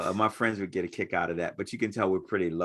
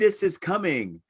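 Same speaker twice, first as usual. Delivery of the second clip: higher-pitched mock promo line; imitated teaser, pitched well up; promo slogan; 10 semitones higher than usual. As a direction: intone it like a comic-book teaser. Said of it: "Remember the promo".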